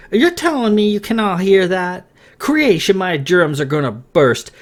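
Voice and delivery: can't believe they don't hear it; alarmed disbelief. A narrator's reading. Morgan alarmed, others hear nothing.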